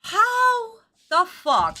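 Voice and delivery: slow, high disbelief; drawn-out exasperated question; incredulous. Real, not acted. Incredulous at the plot.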